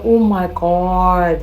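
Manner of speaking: stretched-out dismay. Retold or imitated. Realising she upset the neighbor.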